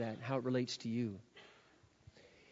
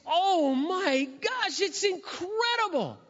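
much higher, slow, drawn-out wonder; gushing awe; 12 semitones higher, much slower than usual. Retold or imitated. Relatives seeing his new face, gushing high and slow. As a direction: gush over him in awe.

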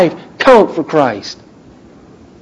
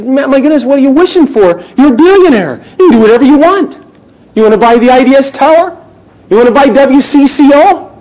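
amazed friend; 9 semitones higher, 4 dB louder than usual. Retold or imitated. Man voicing surprised friend.

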